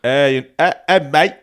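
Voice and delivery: lightly put-on British accent; badly faked British mate talk. Shown as a demonstration. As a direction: try a mock British greeting. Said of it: "good British accent".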